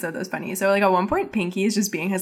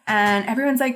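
ordinary talk

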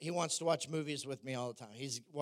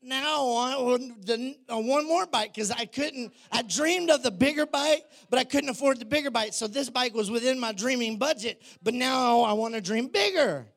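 raised, energetic sermon voice; preaching; 6 semitones higher, 5 dB louder than usual. Acted. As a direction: drive the point home to the congregation. Preaches to congregation.

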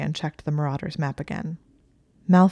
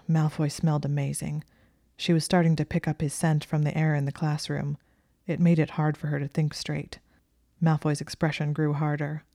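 usual speaking voice

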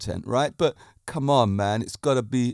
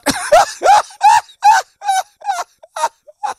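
long, very high helpless laughter; 9 dB louder than usual. Real, not acted. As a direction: laugh until you lose it. Long laugh after punchline.

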